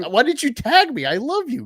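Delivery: high, gushing fanboy cry; mock gushing. Retelling his reply to Goalpost, high and gushing. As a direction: overflow with affection for Goalpost. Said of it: "And, like".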